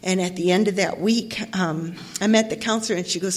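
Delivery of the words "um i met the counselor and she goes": ordinary talk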